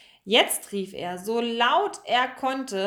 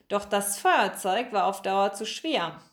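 usual speaking voice

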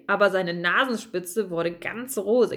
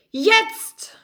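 high, loud shout; 11 semitones higher, 6 dB louder than usual. Acted. Bertram gives the jump signal, a loud high shout. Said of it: "rief Bertram".

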